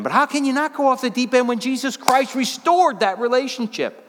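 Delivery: high, loud, fervent preaching; worked-up preacher going off the deep end; preaching at full force. Real, not acted. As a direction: let it rip from the pulpit. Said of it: "He's going off the deep end".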